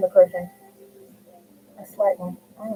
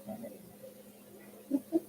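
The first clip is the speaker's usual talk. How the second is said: whisper; 4 semitones higher, 19 dB quieter, much slower than usual. Real, not acted. Whispered second take to herself.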